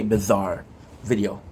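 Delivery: ordinary talk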